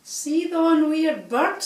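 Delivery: high, slow, frightened swan; quivering with fear. A narrator's reading. Mister Swan eyes the strange bird, a touch of fear. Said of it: "voice quivering with fear".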